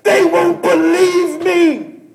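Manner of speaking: slow, loud and high, near crying; slow, crying shout; pained cry. Acted. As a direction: drag it out like a wounded cry.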